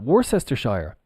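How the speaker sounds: "Worcestershire" as WOR-sess-ter-SHIRE; mock wrong pronunciation. Shown as a demonstration. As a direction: sound out "Worcestershire" fully, ending like "shire". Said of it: "No, absolutely not".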